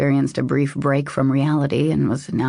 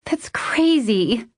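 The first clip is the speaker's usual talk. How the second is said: high, slow, incredulous exclamation; astonished; 8 semitones higher, slower than usual. A narrator's reading. Woman reacting to his proposal, astonished. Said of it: "in complete astonishment".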